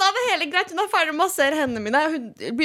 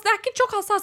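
ordinary delivery

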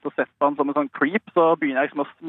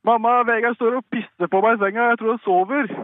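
higher-pitched mock cry for mum; imitating brother's call; 7 semitones higher than usual. Brother calling mum from his bed at night, mock calling out. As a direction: call out as a boy would, without really yelling. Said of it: "Eirik som roper sånn".

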